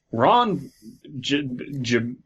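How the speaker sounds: ordinary talk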